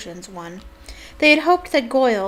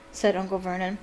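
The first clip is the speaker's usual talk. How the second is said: ordinary delivery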